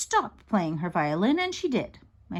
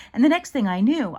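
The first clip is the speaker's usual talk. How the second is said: ordinary delivery, nothing marked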